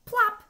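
high, loud "plop" sound effect; imitated plop. A narrator's reading. Storyteller voices food dropping into open mouth, high plop.

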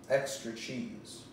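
ordinary delivery

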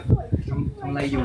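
slow, low and soft; soft low-pitched question, drawn out; low natural voice. Shown as a demonstration. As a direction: let the voice slip down low, unhurried. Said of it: "เผลอพูดเสียงแบบปกติตอนเสียงต่ำ".

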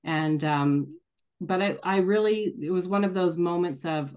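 normal talking voice

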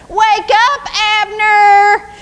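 high, loud call across the valley; David yelling down at Abner from the hill, high and loud; shouting up the hill. Acted. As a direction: bellow it to wake the camp. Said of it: "he shouted down at the soldiers".

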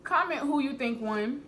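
ordinary delivery

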